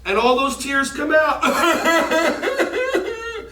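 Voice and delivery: real laughter mid-cue; laughing. Real, not acted. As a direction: giggle the words out. Laughing while shooting out tears.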